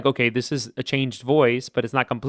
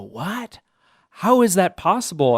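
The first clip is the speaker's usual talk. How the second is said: imitating surprised students; 6 semitones higher, 4 dB louder than usual. Students reacting to bass-to-tenor story.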